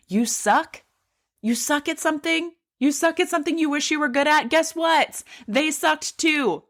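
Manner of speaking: high, mocking taunt; teasing challenge. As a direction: needle them playfully. Mocking the listener's excuses.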